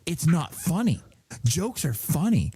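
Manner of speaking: mock scolding child, slow on the last words; a hint of a huffy daughter; indignant kid voice. Kid scolding mom in story.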